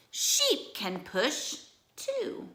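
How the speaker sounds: high, slow frog voice; croaky frog. Acted. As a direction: spell out the plan as the frog. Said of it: "croaks Frog".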